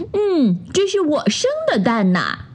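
high, delighted duck mother voice; beaming over her own egg; proud quacking joy. A narrator's reading. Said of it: "乐得嘎嘎嘎地叫". (鸭妈妈得意又开心地说)

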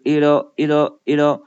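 "it'll" with the t softened to a d, slowly; slow "it'll", t voiced like a light d. Shown as a demonstration. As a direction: say "it'll" as "iddle", slow and separate. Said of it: "浊化成了的".

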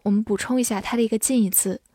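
normal talking voice

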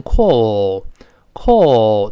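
British "call" with rounded long aw; slow, rounded British "call"; British pronunciation. Shown as a demonstration. Teacher demonstrates how Britons say call, British accent. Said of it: "英式发音呢读成".

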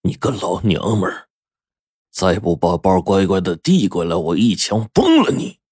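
restrained gun-toting menace; threatening. A narrator's reading. Robber ordering a woman to hand over her bag, threatening. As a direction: threaten with understated force. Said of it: "威胁着说".

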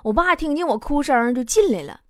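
ordinary delivery

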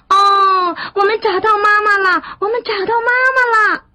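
put-on high, loud childlike cheering; overjoyed. A narrator's reading. Tadpoles celebrating finding their mother, high joyful cheer. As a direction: squeal with joy, speeding up on the repeat. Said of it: "高兴地".

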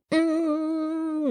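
long, soft, high sustained hum; lingering hum. Shown as a demonstration. Teacher illustrating a piano's lingering sound, lingering hum. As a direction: hum like a piano note ringing on. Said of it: "它会有一个余音绕梁那种感觉".

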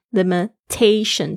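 Limitation slowly, ta stressed. Shown as a demonstration. Teacher modelling Limitation stress for students, slowly. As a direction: lean on ta in Limitation. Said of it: "重音在t上面".

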